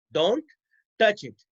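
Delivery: "Don't", pause, then "touch it"; word-by-word dictation. Shown as a demonstration. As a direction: leave a clear gap after "Don't". Repeats example word by word.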